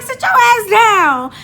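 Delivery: loud, high, drawn-out order; exasperated yell. Retold or imitated. Driver ordering passenger off the wheel, exasperated shout. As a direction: holler it slowly, imitating his alarm.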